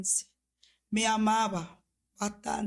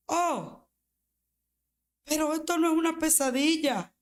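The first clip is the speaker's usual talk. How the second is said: retold realization; 5 semitones higher than usual.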